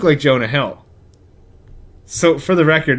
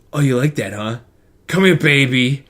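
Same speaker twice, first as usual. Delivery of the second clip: lightly voiced sleazy Nick Cage; Nick Cage impression. Nick Cage grabbing the nanny, light sleazy put-on voice. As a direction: purr it like a sleazy leading man. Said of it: "my Nick Cage impression".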